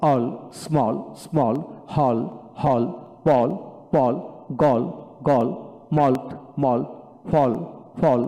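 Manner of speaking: each word twice, evenly, with falling tone; single-pronunciation drill. Shown as a demonstration. Teacher models twice-repeated words for a child, single pronunciation. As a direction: read the words one by one, vowel unchanged. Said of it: "single উচ্চারণ পড়াবেন".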